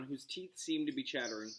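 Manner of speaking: ordinary delivery, nothing marked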